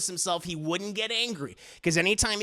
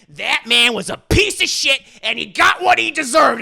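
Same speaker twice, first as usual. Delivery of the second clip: loud, hateful shout; furious outburst; 6 semitones higher, 11 dB louder than usual. Acted. Dio snapping at JoJo about his father, shouting. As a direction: spit out the hatred at full volume.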